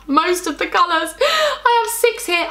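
high, slow guilty squeak; sheepish confession. Real, not acted. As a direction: confess it like caught out. Admitting owning most colours.

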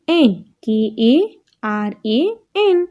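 letters spelt out slowly one by one; slow letter-by-letter spelling. Shown as a demonstration. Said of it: "એનું જોઈએ".

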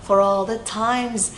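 slow "For all the times", sounds linked; linked demonstration. Shown as a demonstration. Teacher models "For all the times" for learners, linked and slow. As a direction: draw out "For all the times" with linking. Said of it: "ở đây đọc nối ha".

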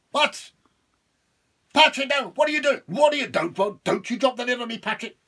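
high, loud, frantic devil cries; panicked devil. Acted. Devil begging Patrick, panicked and loud. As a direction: scream as the lid comes down.

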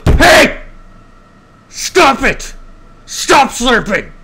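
very loud, high furious scream; explosive hammed-up scolding yell; maniacally mad. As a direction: scream like an enraged grandmother. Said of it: "She would get maniacally mad".

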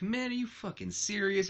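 can't believe what he's seeing; incredulous scorn. Retold or imitated. Booker staring at Kurt's outstretched hand, incredulous. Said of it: "looks at Kurt's hand like".

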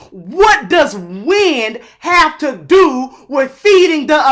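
slow, furious shout hammering each word; angry shouting. Real, not acted. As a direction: yell it out, outraged and slow. Angry rant about the bill.

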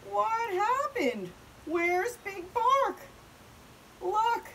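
high, drawn-out cries of shock; alarmed. Acted. Prairie dogs searching for Big Bark, alarmed. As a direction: call out in stretched, high alarm.

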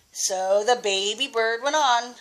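ordinary delivery

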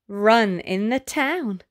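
ordinary delivery

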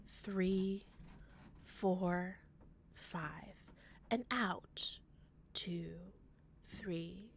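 soft, hushed, soothing guide; soft echoing dream voice. Acted. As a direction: breathe them through it gently. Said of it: "A soft voice called out to me".

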